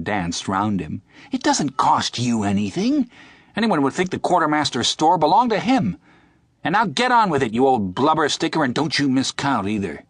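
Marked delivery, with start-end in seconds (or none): 1.3-10.0 high, louder taunting jeer at the cook; mock the stingy cook gleefully. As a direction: soldier taunting the cook as he dances round him. Tjaden jeering at the cook.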